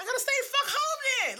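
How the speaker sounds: high, fast exasperated retort; sarcastic outrage. Retold or imitated. Snapping back at the truancy officer, high and exasperated. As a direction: fire it off high and fed up.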